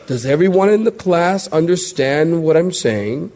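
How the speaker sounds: usual speaking voice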